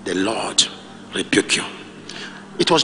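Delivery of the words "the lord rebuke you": much lower, softer, solemn rebuke; hushed rebuke. Acted. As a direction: speak the rebuke low and grave. Lord rebuking Satan.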